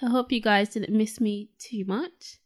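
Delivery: ordinary talk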